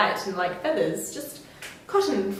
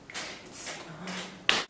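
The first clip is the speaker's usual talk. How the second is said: whispered; 5 semitones lower, 12 dB quieter than usual. Real, not acted. Whispered snow.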